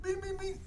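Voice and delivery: high-pitched machine beeps; imitated beeping. Teller mimicking the airport alarm at dad's fake joints, high beeping. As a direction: mimic a metal detector going off.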